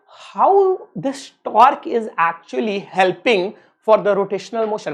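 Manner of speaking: each word spaced out slowly for note taking; slow dictation. Acted. Teacher dictating a definition to his students, very slow and spaced. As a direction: read it out very slowly so they can write.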